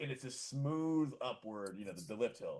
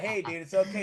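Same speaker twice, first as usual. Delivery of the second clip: higher and louder, laughing through it; laughing reassurance; 7 semitones higher, 9 dB louder than usual. Retold or imitated. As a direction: reassure him while cracking up.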